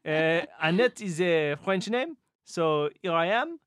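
halting comic Frenchman; mock French accent. Player moving on to the puzzle, put-on French accent. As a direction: reason it out in a hammed-up French accent. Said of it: "speak like a, a Frenchman".

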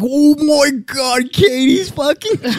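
very high, drawn-out mock amazement; retold astonishment. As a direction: squeal it slowly, hamming up the shock. Retelling his astonished discovery.

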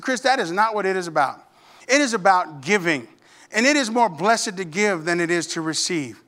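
sit him down and teach gently; earnest admonishing. Retold or imitated. Mother sits him down, corrects him.

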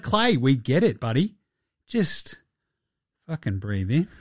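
calm him with weary patience; mock soothing. Friend talking an agitated Clay down, mock soothing. Voices someone calming Clay down.

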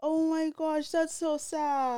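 high, drawn-out dismay; aching for the character; pained sympathy. Real, not acted. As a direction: draw it out like a sympathetic whine. Dismayed reaction to sad story.

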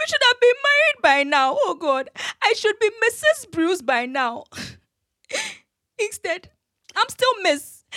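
a touch of tearful bitterness; grieving outburst. Acted. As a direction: pour out grief over a lost marriage. Bereaved fiancée laments lost marriage.

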